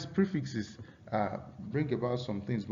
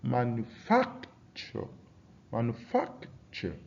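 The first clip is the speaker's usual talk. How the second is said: "manufacture" slowly, with FAC drawn out; stress on second-last syllable; 4 dB quieter, slower than usual. Shown as a demonstration. Teacher giving an example of stress, FAC stretched in "manufacture". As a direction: hold the FAC in "manufacture", then a short "ture". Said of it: "appear on the second syllable".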